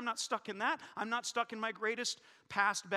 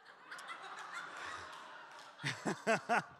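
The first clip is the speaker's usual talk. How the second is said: laugh; 4 dB quieter than usual. Real, not acted. Laughing at own joke.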